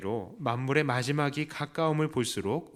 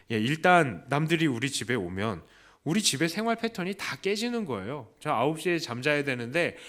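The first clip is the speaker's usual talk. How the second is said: ordinary talk, nothing marked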